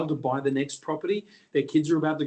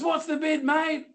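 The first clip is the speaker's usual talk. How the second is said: heckling bloke; 12 semitones higher, slower than usual. Retold or imitated. Heckler yelling from passing ute.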